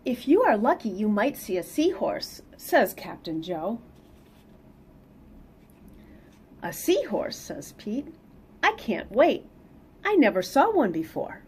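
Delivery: lively read-aloud storytelling; picture book reading voice; story narration. Acted. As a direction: read it to the kids with bounce.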